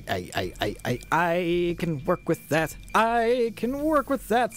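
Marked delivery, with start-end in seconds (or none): 2.9-4.5 much higher, drawn-out squeaky echo; high-pitched repeat. Pretend.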